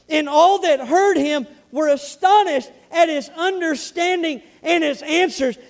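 loud, high, driving sermon voice; fiery preaching. Real, not acted. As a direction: preach it out with full force. Preaching at full force.

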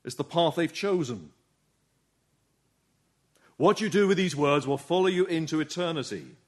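usual speaking voice

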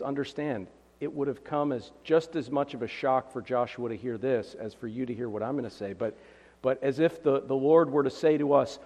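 normal talking voice

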